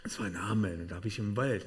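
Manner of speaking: ordinary delivery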